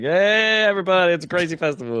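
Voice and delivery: loud, high hype announcer voice; hyped party host. Retold or imitated. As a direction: shout it like a wild festival host. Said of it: "and they're like".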